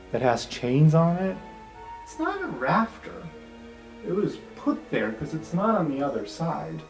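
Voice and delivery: ordinary talk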